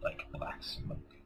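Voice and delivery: hushed spooky story reading; eerie whispered reading. Acted. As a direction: read it low like a ghost story. Reads book excerpt eerily.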